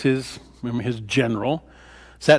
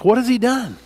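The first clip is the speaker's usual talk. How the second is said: pitched higher, urgent; pleading question; 9 semitones higher than usual. Retold or imitated. Jonathan asking his father, pleading, higher. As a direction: ask it as a desperate son. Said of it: "He says".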